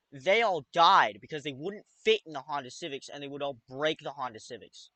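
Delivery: ordinary delivery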